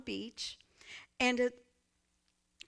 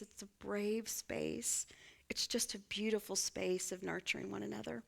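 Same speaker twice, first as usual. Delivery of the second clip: a tender whispered aside; 3 semitones lower, 6 dB quieter than usual. Real, not acted. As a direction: breathe it softly to them. Describing the sacred space.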